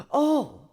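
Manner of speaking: a sudden loud gasp of surprise; sharp gasp. A narrator's reading. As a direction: catch your breath, startled, high.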